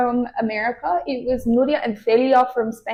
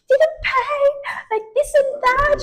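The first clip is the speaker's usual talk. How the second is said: high, slow, put-on voice; imitating giggly friend; slower than usual. Retelling Celia on the train after not paying, high and giddy. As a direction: squeal it out like a friend laughing at a bad situation. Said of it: "Celia starts laughing like a maniac".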